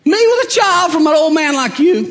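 high, amused disbelief; mock her laughing at the old man; high amused voice of Sarah; laughing old wife. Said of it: "She starts laughing".

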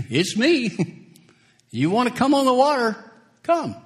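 Jesus beckoning Peter from the waves, high and urgent; voicing Jesus. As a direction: call him out onto the water, high and eager. Jesus invites Peter onto water.

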